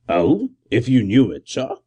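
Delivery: lightly voiced policeman, respectful; deferential constable. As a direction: report it to the gentleman.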